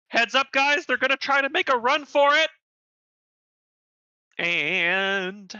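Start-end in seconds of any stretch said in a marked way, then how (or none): 0.1-2.5 raised, higher-pitched warning call; shout to allies. Acted. Del Nadre warning allies of a run for it, shouting. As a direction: call out a warning across the deck. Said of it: "going to shout to his allies".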